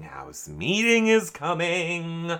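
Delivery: stretched, lilting mock warning; drawn-out omen. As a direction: draw out "House meeting is coming" like a looming omen. Repeats phrase as mock omen.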